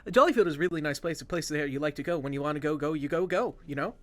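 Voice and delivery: a hint of a fast Jollyfieldian local; Jollyfieldian accent. GM voices a Jollyfield local to the party in a fast Jollyfieldian accent. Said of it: "I have not done the Jollyfieldian accent in a while".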